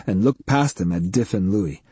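ordinary delivery

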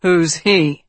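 intense. Acted. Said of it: "said Tedros intensely".

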